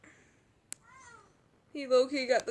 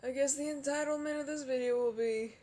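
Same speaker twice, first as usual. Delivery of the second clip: ordinary talk, nothing marked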